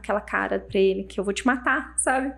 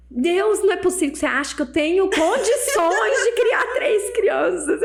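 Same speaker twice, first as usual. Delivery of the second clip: high-pitched, hammed-up plea to God; mock despairing wail; 7 semitones higher than usual. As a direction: plead tearfully, playing it up. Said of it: "ó, chorando". (lamento choroso exagerado)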